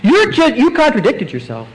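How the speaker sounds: high, protesting voice of an offended doubter; indignant doubter. Retold or imitated. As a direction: throw it back at God as if it were unfair, half laughing. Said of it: "how many of us, I think, would react".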